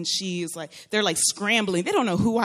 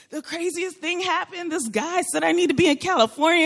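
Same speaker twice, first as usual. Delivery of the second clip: high-pitched, breaking-news excitement; excited disbelief; 9 semitones higher than usual. Retold or imitated. Daughter phoning mom about a bizarre encounter, excited disbelief. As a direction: spill it to mom in a high, amazed voice. Retold excited call to her mother.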